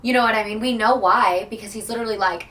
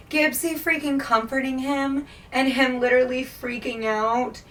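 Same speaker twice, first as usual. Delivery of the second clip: normal talking voice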